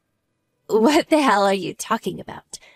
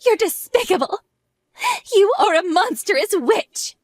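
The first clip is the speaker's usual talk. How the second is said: furious accusation; 8 semitones higher than usual. Acted. Confronting rival over rumors.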